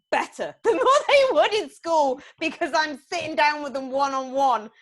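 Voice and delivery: forceful, high-pitched vindication; emphatic defiance. Real, not acted. Defends teaching her own children.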